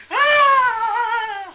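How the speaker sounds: hammed-up high shriek; mock scream. Mocking the overacting women with a hammed-up scream. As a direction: fake horror-movie scream, way over the top.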